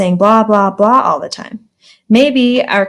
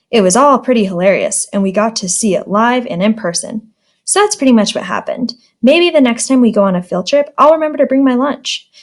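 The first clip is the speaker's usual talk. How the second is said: ordinary delivery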